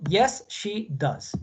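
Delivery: drawn-out, careful answer; teacher modelling a short answer very slowly; very slow model answer. Shown as a demonstration. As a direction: stretch every word for the class. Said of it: "para responder positivo".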